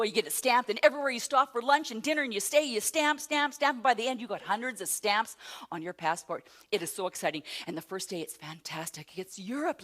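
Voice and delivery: ordinary talk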